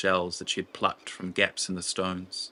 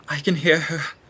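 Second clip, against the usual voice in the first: much higher, louder outburst of alarm; anguished cry; 9 semitones higher, 8 dB louder than usual. Acted. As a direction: cry out as the grief hits her. Nima's anguish rises.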